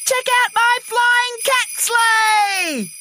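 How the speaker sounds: very high, drawn-out excited call. A narrator's reading. Jill calls from her flying cat sleigh, high excited shout. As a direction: show it off, calling across the sky.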